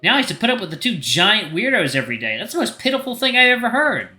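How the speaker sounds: high-pitched angry outburst; sudden anger. Acted. Jealous girl insulting the two friends, angry. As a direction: pitch it up and rant scornfully. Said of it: "why are you so angry all of a sudden".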